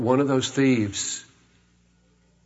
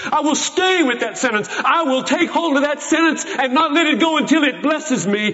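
higher, pressing resolve; fervent vow; 11 semitones higher than usual. Acted. Preacher vows to cling to the sentence, fervent and high. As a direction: grab hold and won't let go.

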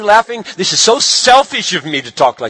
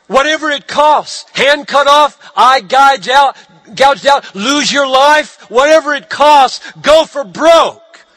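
higher, louder, building preaching cry; fervent preaching; 7 semitones higher, 3 dB louder than usual. Real, not acted. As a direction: thunder it out to the congregation. Preacher's climactic exhortation.

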